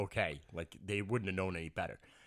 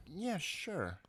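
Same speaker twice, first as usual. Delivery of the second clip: softer, drawn-out brush-off yes; insincere agreement; 7 semitones higher, 5 dB quieter than usual. Retold or imitated. Host imitating a reluctant guest, drawn-out insincere agreement. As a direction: agree just to get rid of them. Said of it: "just gonna say this to you so that you go away".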